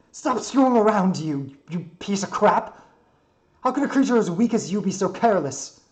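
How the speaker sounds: high, loud outburst; furious scolding. Acted. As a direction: berate him at full voice after a scare. Character berates the careless builder.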